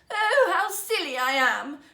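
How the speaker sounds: slow, loud sobbing lament. Acted. Princess outside the gates, loud sobbing lament. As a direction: wail it through tears. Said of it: "she sobbed".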